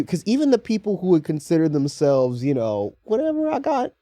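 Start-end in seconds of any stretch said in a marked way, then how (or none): 3.0-3.9 lightly mocking self-satisfied voice, pitched up; imagined internal monologue. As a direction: lightly imitate someone excusing themselves. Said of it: "what I think their internal monologue is".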